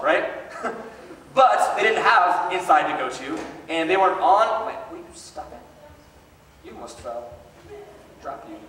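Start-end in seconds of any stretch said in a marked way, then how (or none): 4.7-8.6 hushed aside. Real, not acted. Aside to a kid nearly falling.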